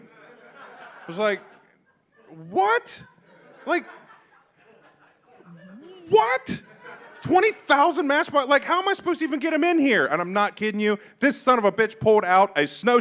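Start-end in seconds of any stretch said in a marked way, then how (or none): 2.5-10.1 high, squeaky disbelieving what; stunned disbelief. Retold or imitated. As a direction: pitch jumps way up in shock at the offer. Stunned reaction retold.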